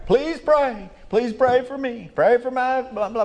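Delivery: high, whiny mock plea for prayers; mock pleading. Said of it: "then it's on there".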